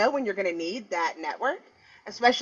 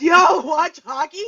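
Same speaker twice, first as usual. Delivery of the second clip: Canadian guy; 6 semitones higher, 7 dB louder, slower than usual. Retold or imitated. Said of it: "And then he goes".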